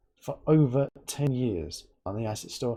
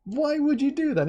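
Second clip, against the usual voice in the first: mock incredulous; 12 semitones higher than usual. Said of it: "He's like".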